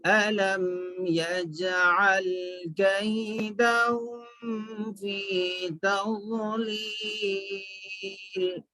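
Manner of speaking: slow, measured tajweed chanting; Quran recitation. Shown as a demonstration. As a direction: draw out the long vowels, fading on the last. Recites verses for learners.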